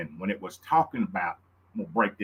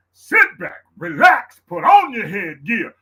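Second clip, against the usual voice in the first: sing-song hype; 9 semitones higher, 9 dB louder, slower than usual. Acted. Hyping tomorrow's show to fans.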